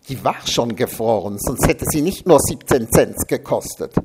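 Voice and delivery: ordinary delivery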